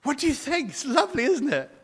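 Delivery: high, gushing show-off; mock gushing. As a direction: gush over the new house. Mimicking a proud homeowner.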